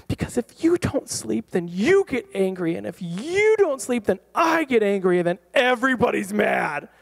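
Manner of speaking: high, exasperated parent losing it; emotional outburst. Retold or imitated. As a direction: swoop up high on the contrasts, frazzled. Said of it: "like, emotional".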